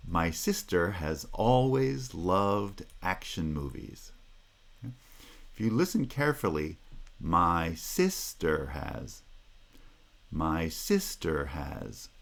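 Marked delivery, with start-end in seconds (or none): 0.1-4.1 slow, beat on each stressed syllable; rhythmic demo. Shown as a demonstration. Teacher demonstrating sentence rhythm in time with the animation. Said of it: "let's see if I can time it with my animation". 7.2-12.1 slow, pitch going up on "sister has"; pitch rise on sister. Shown as a demonstration. Teacher showing the pitch rise on "sister has". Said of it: "The pitch of the voice goes up for sister has".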